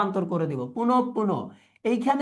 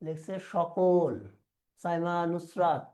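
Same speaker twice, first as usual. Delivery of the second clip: normal talking voice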